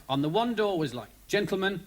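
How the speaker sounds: ordinary delivery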